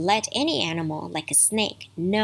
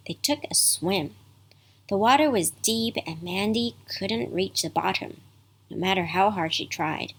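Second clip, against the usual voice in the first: usual speaking voice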